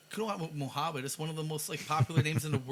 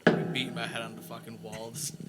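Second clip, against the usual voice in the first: ordinary talk, nothing marked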